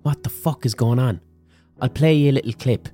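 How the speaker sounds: usual speaking voice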